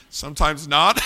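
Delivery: real laughter through the words; laughing. Real, not acted. Preacher laughs at his own joke, amused. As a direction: laugh as the line lands.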